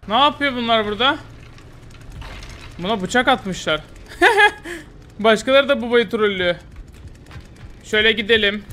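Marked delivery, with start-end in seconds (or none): 4.2-4.8 high burst of laughter; sudden high laugh. Real, not acted. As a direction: laugh out at the killer. Laughs at the killer.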